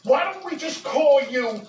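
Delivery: ordinary talk, nothing marked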